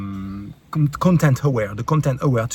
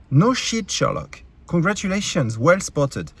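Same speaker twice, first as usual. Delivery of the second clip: mock congratulations with swinging pitch; sarcastic retort; 3 semitones higher than usual. Real, not acted. YouTuber answering an obvious comment, heavily sarcastic.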